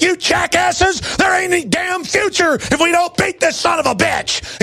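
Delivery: high, furious shout building in rage; angry shouting rant. Real, not acted. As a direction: yell it at the media in real anger. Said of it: "I'm not trying to talk like that".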